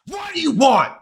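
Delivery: high, loud furious shouting at the caller; angry yelling. Retold or imitated. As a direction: bite the caller's head off, hammed up. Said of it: "I'm all fucking pissed off".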